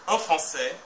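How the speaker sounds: ordinary talk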